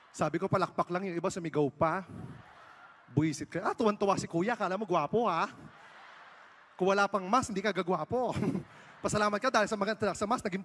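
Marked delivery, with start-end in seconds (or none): none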